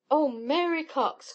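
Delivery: put-on girlish exclamation to a friend; excited cry. A narrator's reading. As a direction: call out with delight, drawing it out. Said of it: "cried Helen".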